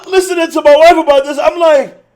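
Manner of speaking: high, loud and fast through laughter; real laughing disbelief. Real, not acted. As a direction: crack up while telling it.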